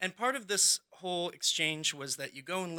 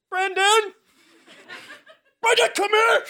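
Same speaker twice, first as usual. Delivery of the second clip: desperate yell; 9 dB louder than usual. Retold or imitated. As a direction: shout the name high and loud across the house. Calling friend from flooded bathroom.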